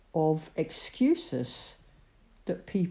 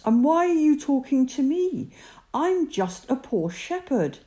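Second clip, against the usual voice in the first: Moses pleading; 9 semitones higher, 5 dB louder than usual. Acted.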